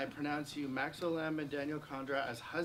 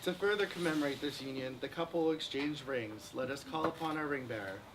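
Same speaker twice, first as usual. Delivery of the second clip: usual speaking voice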